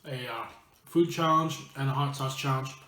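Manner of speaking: talking through a mouthful of chips; chewing while speaking; messy loud eating. Real, not acted. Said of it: "I'm gonna be talking with my mouth full".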